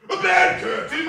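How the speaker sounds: loud, high, laughing mockery. Woman laughs over the dead man's curtains, loud and high. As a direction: crack up as you ask it. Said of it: "laughing and leaning forward".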